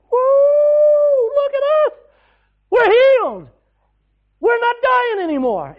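loud, high whoops of the healed men; joyful shouting. Acted. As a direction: shout it out, overjoyed and free. Healed lepers shouting with joy.

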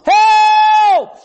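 high, loud, long-held yell; scream for help. Acted. Preacher acting out someone in trouble, screaming for help. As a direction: yell it at the top of your lungs. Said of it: "scream at the top of your lungs".

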